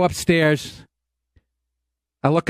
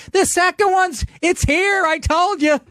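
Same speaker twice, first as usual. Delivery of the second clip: insistent wife; 10 semitones higher, slower than usual. Retold or imitated. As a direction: play the wife who was right all along. Wife insisting about second bat.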